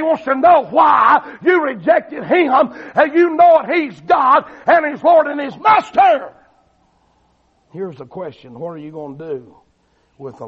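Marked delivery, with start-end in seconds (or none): 0.0-6.3 high, loud shouted preaching; full-force preaching. Real, not acted. Preacher declaring he's Lord and Master, full-force preaching. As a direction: drive every short phrase home at a shout.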